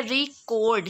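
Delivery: "Record" as a verb, slow stretched "cord"; stress on second syllable. Shown as a demonstration. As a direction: lean on the "cord" of "record", drawing it out. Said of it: "कोड को बहुत ज़्यादा slow वे में". ("Record" में "cord" पर ज़ोर)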